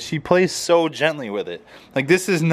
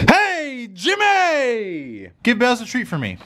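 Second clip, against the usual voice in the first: calling out high; 9 semitones higher, slower than usual. Pretend.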